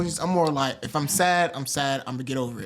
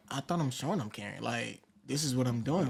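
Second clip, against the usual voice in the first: much softer, puzzled thinking to himself; inner voice; 2 semitones lower, 8 dB quieter than usual. Retold or imitated. Husband recalling his unspoken confusion, soft and inward. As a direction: mutter it as a private thought. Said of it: "in my head, I'm like".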